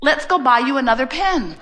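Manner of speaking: soothing husband. Retold or imitated. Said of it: "And Jerry said".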